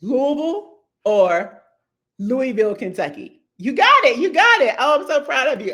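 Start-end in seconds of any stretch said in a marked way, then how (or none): 0.0-0.8 Louisville drawn out slowly as LOO-uh-vul; slow model Louisville for repeating; slow local pronunciation. Shown as a demonstration. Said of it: "say it with me". 2.2-2.7 Louisville said LOO-ee-vil, no s; Louisville with a clear ee and no s; alternate pronunciation. Shown as a demonstration. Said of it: "say it with me". 3.6-5.7 cheering praise. Pretend. Praising viewer after repeating.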